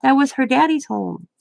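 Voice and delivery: put-on high voice for talking to a child; retold grandma-to-child explanation; grandma explaining. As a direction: explain to a grandchild, pitched well up. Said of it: "I'm like".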